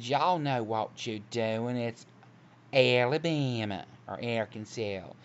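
slow Southern drawl, a sitcom character imitation; Jolene Honeycutt drawl. Woman quotes a comic sitcom character, slow imitated Southern drawl. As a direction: stretch the vowels long in an exaggerated Southern drawl. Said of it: "She talked like that".

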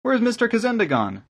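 high-pitched youthful question; high voice falling at the end; young visitor. A narrator's reading. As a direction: ask in a light, high young voice.

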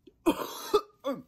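high loud coughing; harsh cough. Real, not acted. Burning throat from the chip, loud coughing. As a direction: choke on the heat.

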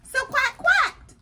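very high, loud quacks; duck cry. Acted. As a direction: squawk it out like a duck. Duck crying out on the moon.

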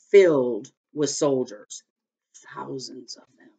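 slow, weighty, fading to a hush; dramatic reveal. A narrator's reading. Narrator reveals thousands of soldiers, dramatic hushed reveal. Suspenseful reveal of the tomb army.

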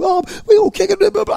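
high, loud mocking of boastful kids; hammed-up bragging teens; mock tough boasting. Mimicking the kids bragging about fighting.

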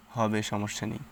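usual speaking voice

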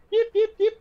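high, loud little yips; squeaky tiny dog yapping; yappy chihuahua voice. Retold or imitated. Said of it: "but it's like".

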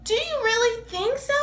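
hope against hope; Big Bird hopeful. Acted. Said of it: "said Mister Snuffleupagus".

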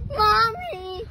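a second, gentler fake cry. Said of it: "Let me hear you fake cry".

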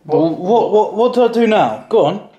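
ordinary talk

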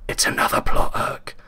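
breathy whisper to his partner. A narrator's reading. Detective whispering to Liam about the plot, in a whisper. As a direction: whisper it aside, conspiratorial. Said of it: "I whispered to Liam".

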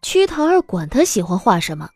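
ordinary talk, nothing marked

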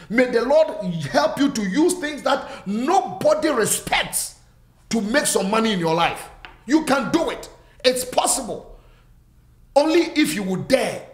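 rising and falling preacher's cadence; fervent preaching. Acted. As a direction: preach it to the whole church with conviction. Preaching to congregation.

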